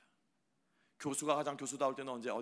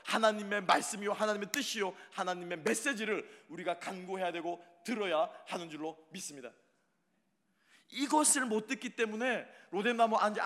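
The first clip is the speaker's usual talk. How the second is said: higher, louder pulpit exhortation; fiery sermon exhortation; preaching at full force; 8 semitones higher, 8 dB louder than usual. Acted. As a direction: build and pound each phrase.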